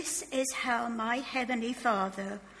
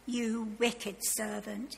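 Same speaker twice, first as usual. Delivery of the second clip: ordinary delivery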